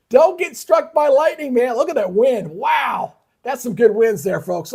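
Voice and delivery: calling out at the screen in excitement; real excited storm-watching; worked up. Real, not acted. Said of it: "kinda got worked up there".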